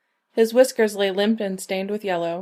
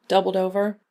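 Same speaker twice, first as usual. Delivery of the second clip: plain reading voice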